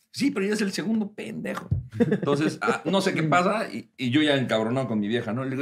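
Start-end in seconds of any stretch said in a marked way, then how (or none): none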